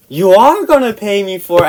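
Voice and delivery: high, loud indignant demand; imitated irate cousin; angry demand. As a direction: insist on being paid back. Cousin demands money in quarrel.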